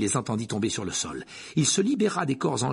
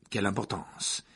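ordinary delivery, nothing marked

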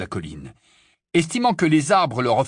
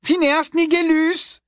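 high, louder, slow call; louder repeat; 5 dB louder, slower than usual. A narrator's reading. Hermione calling the portrait again, louder. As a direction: call the name harder. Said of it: "répéta Hermione".

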